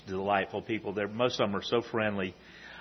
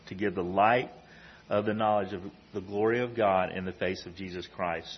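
measured, solemn Bible reading; scripture reading; 4 dB louder than usual. Acted. Preacher reading the trip's verse to the church, solemnly.

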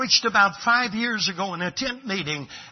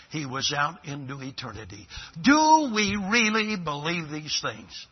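ordinary talk

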